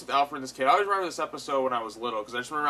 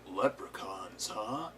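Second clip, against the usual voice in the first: Batman; 5 semitones lower, 9 dB quieter, much slower than usual. Retold or imitated. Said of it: "Batman just, like, saying".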